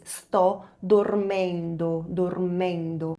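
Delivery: very slow, drawn-out Italian; slow teacher model; slow demo. Shown as a demonstration. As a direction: stretch "dormendo" for learners. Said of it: "você diz".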